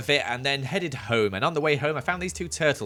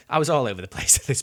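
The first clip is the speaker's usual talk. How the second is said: laughing through the words; chuckling while talking; 4 dB louder, faster than usual. Real, not acted. Laughing while admitting chaos.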